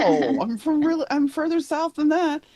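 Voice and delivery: retold protest, voice jumping high; high-pitched protest. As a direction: protest it in a high, insistent retelling. Retold protest to New York guess.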